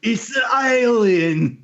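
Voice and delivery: high, slow wail in botched British, nearly Australian; British-turned-Australian accent. Acted. Mocking the alien witness, wailed British-Australian accent. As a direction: cry it out in bad British-Australian. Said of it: "yours comes out as Australian".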